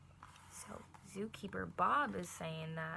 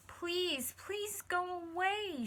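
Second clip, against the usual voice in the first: high, slow pleading; mild storybook sob; 10 semitones higher, 3 dB louder, much slower than usual. A narrator's reading. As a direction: beg it to go away, close to tears. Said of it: "she started to sob".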